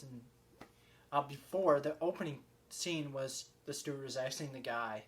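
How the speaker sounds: normal talking voice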